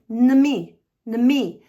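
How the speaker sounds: "nami" as a loud, separate nuh-MEE; isolated word ending. Shown as a demonstration. Teacher isolating the end of enemy, "nami" said emphatically. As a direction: drill "nami" firmly, falling at the end. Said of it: "let's just do the nami".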